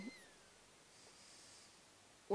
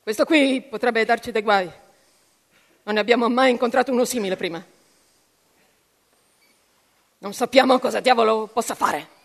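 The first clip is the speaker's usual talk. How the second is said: louder, tense and hurried; irritated warning; 10 dB louder than usual. Acted. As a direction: press the urgency on them. Irritated officer warns his men.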